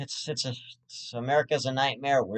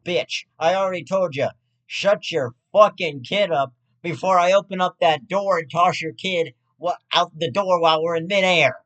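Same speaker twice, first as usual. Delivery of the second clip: loud, higher-pitched angry passenger; acted pissed-off rant; 5 semitones higher, 7 dB louder than usual. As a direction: threaten the parent in mock fury, building louder. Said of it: "because I'm pissed off".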